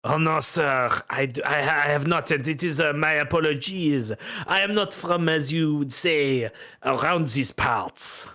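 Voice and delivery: suave French gentleman, unhurried; a light French accent, charming and smooth. Acted. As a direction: purr it out like a smooth French card player. GM voices French fellow again.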